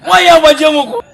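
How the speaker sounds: astonished exclaiming. Acted. Friend reacting to pregnancy gossip, astonished exclaiming. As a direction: call it out loudly in amazement.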